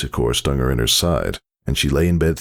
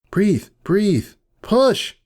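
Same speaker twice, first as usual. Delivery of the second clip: urgent coaching; much slower than usual. Acted. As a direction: press her, climbing to the command. Midwife urging during labour.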